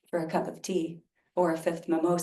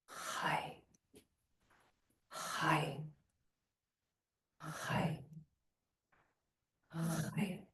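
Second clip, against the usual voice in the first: whispered Hi, softer each breath; 4 semitones lower, 14 dB quieter, much slower than usual. Shown as a demonstration. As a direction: barely breathe the word. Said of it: "And I'll whisper back".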